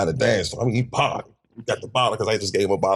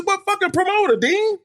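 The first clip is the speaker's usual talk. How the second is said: very high, incredulous question; retold disbelief. Answering the frantic associate, incredulous. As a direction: fire back in mock bafflement. Acts out baffled retort.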